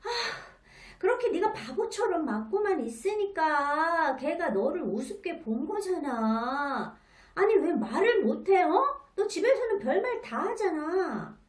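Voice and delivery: put-on frustrated parent yelling blame at the child; angry blaming. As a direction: blow up at the kid in frustration. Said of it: "화를 내며 비난합니다".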